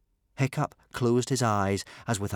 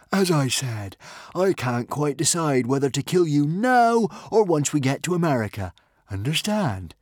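a lightly voiced villain, a bit higher; menacing chieftain; 6 semitones higher, 5 dB louder than usual. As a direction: toy with your captive's life. Norbert threatens Hiccup.

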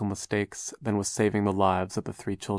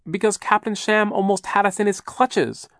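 put-on high, loud girl's outcry; urgent high outcry, louder; cried out; 12 semitones higher, 5 dB louder than usual. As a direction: cry it out urgently. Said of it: "Violet cried".

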